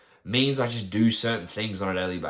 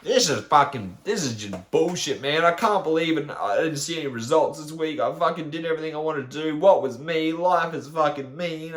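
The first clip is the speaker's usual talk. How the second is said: higher, louder mocking self-pity; mock whiny complaint; 5 semitones higher, 3 dB louder than usual. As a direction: ham up a sulky client moaning about results. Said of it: "and we're like".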